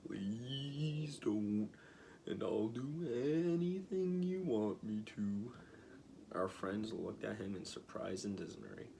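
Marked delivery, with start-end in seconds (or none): 0.1-5.5 Oz pleading. A narrator's reading. Oz pleading in read story.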